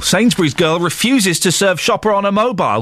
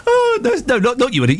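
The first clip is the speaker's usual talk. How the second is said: laughing. Real, not acted. Host laughing at reporter.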